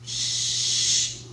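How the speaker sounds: long soft shushing hiss; sliding planchette noise. Retold or imitated. Guest speaker mimics the planchette moving for the audience, drawn-out hushed shush. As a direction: imitate a scraping slide with "shh". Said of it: "then you hear the planchette".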